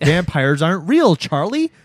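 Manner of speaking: loud, high-pitched taunt; mocking. Prankster jeering at his fooled friend, mocking. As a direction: taunt loudly, pitch climbing.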